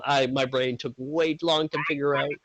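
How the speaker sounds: usual speaking voice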